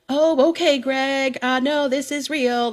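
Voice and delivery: loud, high mock-sweet clapback; sassy. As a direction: belt out a sassy aside mid-song. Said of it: "feeling a little sassy".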